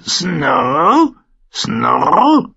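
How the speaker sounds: sehr hoher, lauter Vogelschrei; krächz es wie ein Rabe; krächzender Rabe. A narrator's reading. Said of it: "krächzte der Rabe". (sehr hoch und laut gekrächzt)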